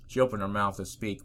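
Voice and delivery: ordinary delivery, nothing marked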